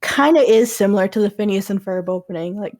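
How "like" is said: usual speaking voice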